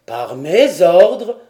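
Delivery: loud, slow, incredulous question; astonished cry. A narrator's reading. Lord learning he supposedly gave orders, astonished. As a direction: exclaim it, drawing out each word. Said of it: "s'écria Glenarvan".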